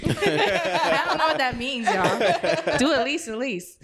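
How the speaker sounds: giggling through the slip; laughing at herself. Real, not acted. Laughing at her own slip to the hosts. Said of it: "Lease to lease is funny".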